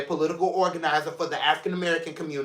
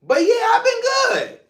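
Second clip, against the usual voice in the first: much higher and louder, exclaimed; joyful shout; 5 dB louder than usual. Real, not acted. Host reassuring viewers about health, shouted cheerfully. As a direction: burst out cheerfully. Bursting upward after laughing.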